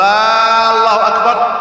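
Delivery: long high chanted AAH opening الله أكبر; stretched opening hamza. Shown as a demonstration. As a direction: draw out "آه" loudly before "الله أكبر". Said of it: "من مد الهمزة".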